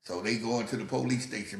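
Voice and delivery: usual speaking voice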